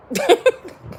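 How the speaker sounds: real high burst of laughter; short laugh. Real, not acted. Speaker amused that he fell for it, short high laugh. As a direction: laugh briefly at your own story.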